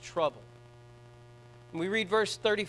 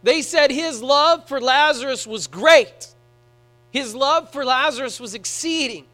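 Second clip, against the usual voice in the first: louder, higher preaching at full force, with slow emphatic peaks; fervent preaching; 5 semitones higher, 6 dB louder than usual. Acted. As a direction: preach it hard to the congregation.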